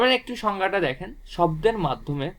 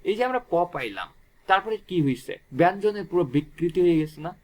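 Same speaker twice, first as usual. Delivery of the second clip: normal talking voice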